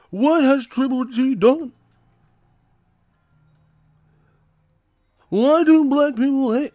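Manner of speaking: very high mocking voice; whining fans. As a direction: imitate indignant fans in a silly high voice. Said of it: "That's all they say".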